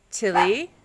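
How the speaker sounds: ordinary delivery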